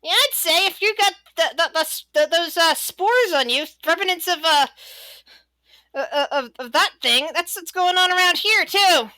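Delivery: high-pitched, halting character voice; kobold guard. Acted. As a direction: stumble through an answer as a little guard. Game master answers as kobold guard.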